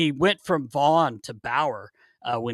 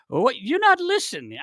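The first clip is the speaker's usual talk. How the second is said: very high put-on voice; imitated goalie complaint; 9 semitones higher than usual. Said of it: "he'd be like".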